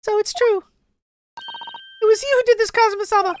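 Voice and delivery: put-on high-pitched girl, shocked reproach; high accusing girl voice. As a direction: accuse him in a high girlish voice. Heroine accusing.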